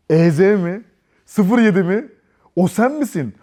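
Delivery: put-on the girl realizing it's him; surprised. Kız plakayı tanıyor. (kızın şaşkın, yüksek sesli soruları)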